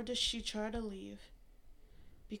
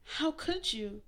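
stammered; 6 semitones higher, 6 dB louder than usual. A narrator's reading. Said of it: "Mary stammered".

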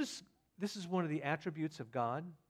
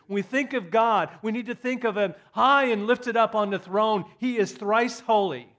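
loud, ringing pulpit proclamation; preaching at full force; 6 semitones higher, 11 dB louder than usual. Real, not acted. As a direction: lift it up and preach it hard.

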